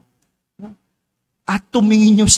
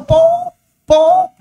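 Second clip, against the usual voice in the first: high loud shouted calls; crowd shouting; 6 dB louder than usual. Retold or imitated. Priest mimicking the shouting crowd, high loud yell. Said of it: "Lahat sumisigaw".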